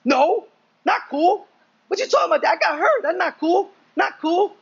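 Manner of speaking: high-pitched imitation of an indignant little kid; mock angry child. Young son scolds dad for not listening, mock angry. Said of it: "he, like, kinda gets angry".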